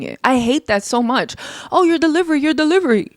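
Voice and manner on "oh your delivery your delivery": high-pitched mimicry of naggers; exasperated mock nagging; mocking critics. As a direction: parrot their complaint in a higher voice. Mimicking critics she hates hearing.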